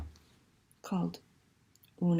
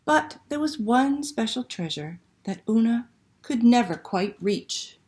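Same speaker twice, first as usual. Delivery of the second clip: slow, lively picture book reading; reading to children; slower than usual. Acted. Librarian reading Una to children online, expressive storytelling. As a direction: read it like a bedtime story to little ones.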